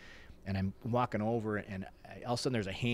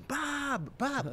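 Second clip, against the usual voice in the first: imitated yell. Runner yelling to Bob a hundred yards away, mock yell. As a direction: act out calling to someone far off. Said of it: "So I'm yelling".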